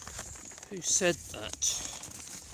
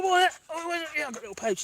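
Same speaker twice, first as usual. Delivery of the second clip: ordinary talk, nothing marked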